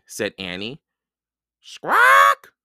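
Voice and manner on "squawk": loud, high parrot screech; sharp bird cry, much higher and louder; parrot squawk. A narrator's reading. Parrot bursts in.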